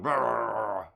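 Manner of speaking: loud, drawn-out dog noise; imitated dog bark. Streamer mimicking a dog heard in the distance, loud bark. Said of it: "and then a dog going".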